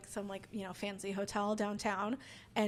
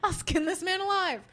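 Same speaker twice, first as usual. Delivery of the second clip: loud, higher mock fury with a laugh; mock rage; 8 semitones higher, 7 dB louder than usual. Comic retelling her rage at the heckler, mock fury. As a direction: threaten him like you mean it, half-joking. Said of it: "'Cause I was like".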